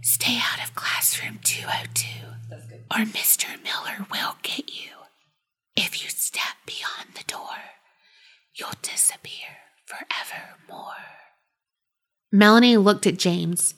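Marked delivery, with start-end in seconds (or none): 0.0-10.9 faint ghostly whisper; haunting voice. A narrator's reading. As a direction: breathe it like a distant ghost. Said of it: "faint, ghostly voice say".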